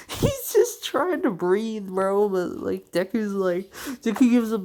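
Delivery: high, loud, laughing retelling; cracking up. Real, not acted. Telling how Ida is just trying to breathe, cracking up. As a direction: laugh helplessly through the words.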